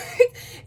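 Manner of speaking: a short unvoiced burst of laughter; little amused huff of a laugh; breathy laugh. Real, not acted. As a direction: laugh at yourself, half embarrassed. Laughs over pronunciation.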